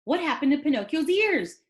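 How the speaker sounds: ordinary delivery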